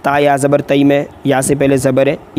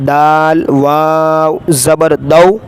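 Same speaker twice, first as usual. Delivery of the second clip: Qaida spelling drill; much slower than usual. Shown as a demonstration. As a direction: call out each letter for the class to repeat.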